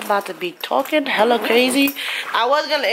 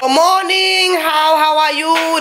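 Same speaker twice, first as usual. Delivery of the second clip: sing-song greeting; 7 semitones higher, slower than usual. Real, not acted. Cheery morning greeting.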